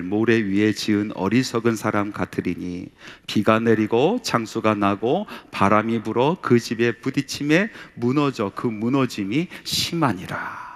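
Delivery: slow, measured Bible reading; solemn slow reading of scripture. Acted. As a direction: read the verses slowly and solemnly. Reads Matthew 7:26-27 aloud.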